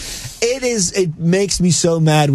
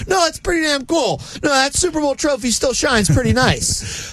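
high, gleeful rebuttal; emphatic; 11 semitones higher than usual. Real, not acted. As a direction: gloat a little.

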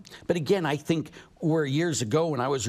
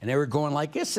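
ordinary talk, nothing marked

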